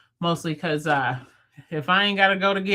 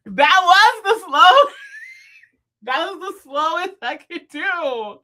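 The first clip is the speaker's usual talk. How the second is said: high-pitched, incredulous laughing defence; squealed, drawn-out protest; 10 semitones higher, 8 dB louder than usual. Real, not acted. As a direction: protest helplessly through laughter. Laughing protest at viewer's remark.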